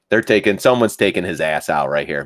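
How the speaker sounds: normal talking voice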